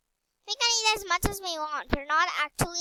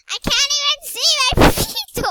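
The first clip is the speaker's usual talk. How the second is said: high, loud laughing exclamation; amused squeal bursting into a laugh; 9 semitones higher, 9 dB louder than usual. Real, not acted. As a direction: break into laughter while exclaiming. Amused at hidden feet in game.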